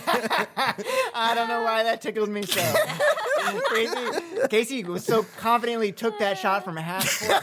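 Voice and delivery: giggling through the words; can't stop laughing while talking. Real, not acted. Laughing fit after joke.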